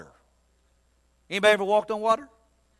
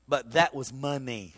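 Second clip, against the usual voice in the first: usual speaking voice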